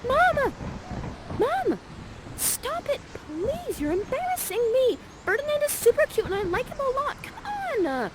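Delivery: put-on high, pleading embarrassed teen; whining teen daughter. Miranda between her mother and Ferdinand, in a high whiny plea. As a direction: beg her mother to stop. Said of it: "is like this".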